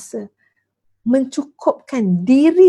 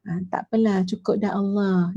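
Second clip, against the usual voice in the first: resigned intonation; 3 semitones lower, 4 dB quieter than usual. Shown as a demonstration. Ustazah showing listeners the wrong intonation, resigned. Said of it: "Bukan macam tu intonasi".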